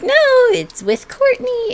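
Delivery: high teasing author voice; mock high fangirl voice. Fan fiction author reassuring Duncan in a mock high voice. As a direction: chirp it up high like a giddy fan. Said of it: "And you're like".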